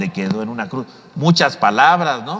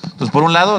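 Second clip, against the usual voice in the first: ordinary delivery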